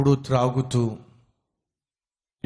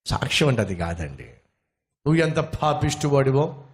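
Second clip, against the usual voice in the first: ordinary talk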